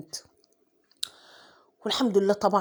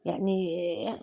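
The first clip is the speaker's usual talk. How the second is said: ordinary delivery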